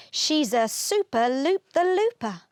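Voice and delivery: plain reading voice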